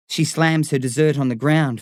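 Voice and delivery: ordinary delivery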